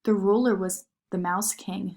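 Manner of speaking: plain reading voice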